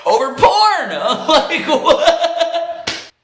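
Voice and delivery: high words squeezed out through laughter; real cracking up in disbelief; incredulous laughing. Real, not acted. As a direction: lose it laughing mid-sentence. Cracks up at own absurd argument.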